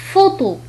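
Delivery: "فوتو" with PH sounded as f. Shown as a demonstration. Teacher showing learners French PH, clear f in "فوتو". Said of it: "تنطق كحرف اف".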